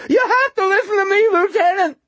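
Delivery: loud, very high yelp; shrill falsetto outcry; yelping homeless man. A narrator's reading. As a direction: yelp it as a desperate plea. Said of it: "he yelped".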